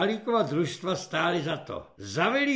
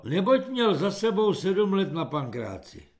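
usual speaking voice

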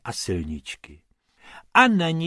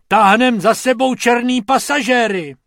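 high, loud outraged bellow; full-voiced indignant yell; roaring; 11 semitones higher, 9 dB louder than usual. A narrator's reading. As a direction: shout the complaint across the cockpit. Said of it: "zařval".

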